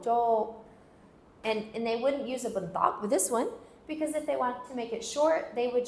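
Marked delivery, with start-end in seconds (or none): 0.0-0.6 "Joke" with a long, drawn O. Shown as a demonstration. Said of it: "This is the long O".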